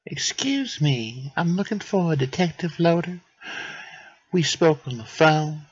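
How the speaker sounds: a visitor politely introducing herself; Odette's voice. Acted.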